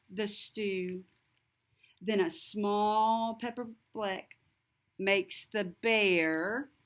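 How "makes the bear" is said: plain reading voice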